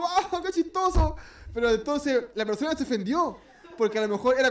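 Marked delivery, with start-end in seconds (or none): 0.0-1.2 mock amused cry. Imitating everyone finding it funny.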